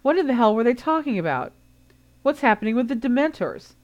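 put-on alarmed teen demanding answers; burst out. A narrator's reading. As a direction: blurt it out without thinking. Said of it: "Ron burst unthinkingly".

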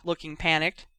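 ordinary delivery, nothing marked

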